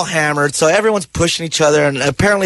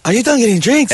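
a light, hammed-up Scottish accent a bit higher; Scottish accent impression; 6 semitones higher than usual. Guest imitates the Scottish singer asking about drinks, in a Scottish accent. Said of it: "Can I hear your impression of him?".

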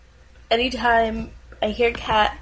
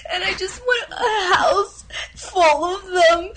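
higher, fast, sobbing and laughing gush; laughing through tears; 5 semitones higher than usual. Acted. Dating video girl melts down over cats, laugh-crying. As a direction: spiral into happy-sad sobbing over cats.

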